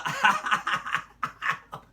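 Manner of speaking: a burst of breathy, airy laughter; real laugh. Real, not acted. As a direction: laugh out loud, unable to hold it. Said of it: "I laughed a lot".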